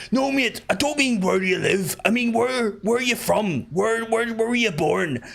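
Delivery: insistent high Belfast cabbie; Belfast taxi driver accent. Acted. Taxi man pressing passenger on his birthplace, insistent Belfast accent. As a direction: press the question in put-on Belfast. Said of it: "that sounds like the taxi man accent".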